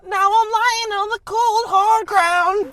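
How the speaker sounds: screaming goat voice, very high and loud. Retold or imitated. Goat scream sound effect sung.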